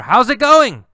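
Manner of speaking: loud, high called greeting; yelled greeting. Acted. Carlos yelling to the girl as she walks, high and loud. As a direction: shout it after her. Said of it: "So he yells to her".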